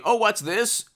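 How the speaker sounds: high-pitched feigned discovery; mock surprise. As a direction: act as if news just popped up. Feigns discovering breaking news.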